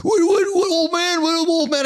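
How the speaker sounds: high, taunting aggressive voice; mocking tough guy. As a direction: play the roided driver sneering at him. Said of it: "He hits brakes, a freaking roided guy".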